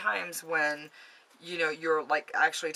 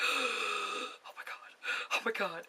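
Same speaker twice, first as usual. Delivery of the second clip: retold hushed, softer gasp of horror; acted-out breath-caught fright; spooked shock; 3 semitones higher, 7 dB quieter, slower than usual. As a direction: let it sink in, softly aghast. Retold shock at ghost encounter.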